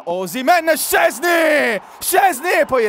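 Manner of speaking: tumbling names out over the rebound goal; breathless italian goal commentary. Real, not acted. Penalty saved then scored.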